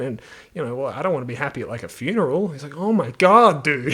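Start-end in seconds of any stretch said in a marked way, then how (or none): 2.8-3.9 louder, higher mock exasperation; exasperated genie. Genie pleading with someone who rejects every wish, exasperated. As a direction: lose patience with a fussy wisher.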